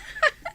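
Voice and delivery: a quick high teasing laugh; bright little giggle of teasing; short laugh. Acted. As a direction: laugh at your friend's secret. Friend teased about Max.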